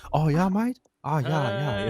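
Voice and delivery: hammed-up Australian bloke; Australian accent. Imitating his brother's Australian accent for friends. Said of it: "brother has that Australian accent".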